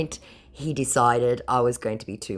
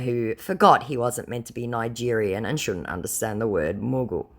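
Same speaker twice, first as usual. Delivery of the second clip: ordinary talk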